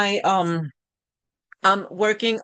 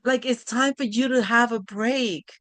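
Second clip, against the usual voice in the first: higher, gently urging Mom; happy kids; 5 semitones higher than usual. Retold or imitated. Kids telling Mom she deserves a break, happy and high. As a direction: cheer Mom on to rest. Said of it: "And they were like".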